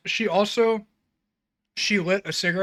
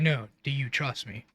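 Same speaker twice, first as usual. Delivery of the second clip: lower, softer, serious retold question; serious insistence; 4 semitones lower, 7 dB quieter than usual. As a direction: press the question gravely, as if quoting. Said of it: "Steve very seriously said".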